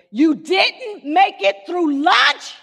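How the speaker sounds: high, slow disbelieving husband; incredulous husband. Retold or imitated. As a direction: stretch it out in disbelief. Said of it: "this wasn't in his honeymoon voice".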